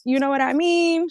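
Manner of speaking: high-pitched mimicking voice; Messy Steph voice. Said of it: "In my Messy Steph voice".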